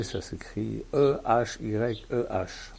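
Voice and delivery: E H Y E H spelled slowly letter by letter; spelling out letters. Shown as a demonstration. Preacher spelling the Hebrew word in French letters, slow. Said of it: "c'est-à-dire en français".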